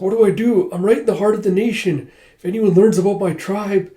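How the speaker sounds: anxious heroine thinking aloud; acted worry. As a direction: fret with mild panic in the voice. Girl frets in a foreign city.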